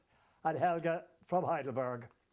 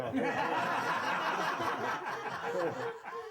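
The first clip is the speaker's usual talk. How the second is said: real laugh; 7 semitones higher than usual. Real, not acted. Laughing at the staged accusation.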